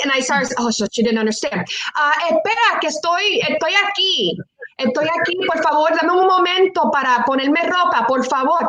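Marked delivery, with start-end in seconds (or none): 2.0-8.6 higher-pitched, flustered Spanish plea; retold begging. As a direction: re-enact pleading for a moment to dress. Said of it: "I'm still begging her".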